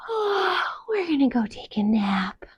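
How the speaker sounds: sighing then whispering; sighed whispered yawn; sleepy kitten whisper. Acted. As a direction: drift off into a nap.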